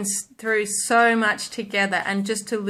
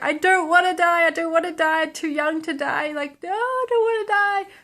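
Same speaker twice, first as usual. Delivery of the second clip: high, pleading mock cry of a creature; voicing the dying pet; 8 semitones higher than usual. Speaker voicing her dying pet's last plea in a high pleading voice. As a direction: squeak it out like a small animal begging to live. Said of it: "and he was like".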